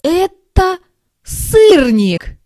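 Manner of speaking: amazed at who it is; excited shout. A narrator's reading. Kittens spot flying mouse. (изумлённо, намного выше и громче)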